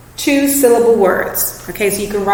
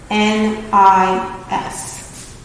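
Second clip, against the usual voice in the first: very slow letters N I S; teacher spelling tennis; spelling out slowly; much slower than usual. Shown as a demonstration. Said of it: "I'll show you what I mean".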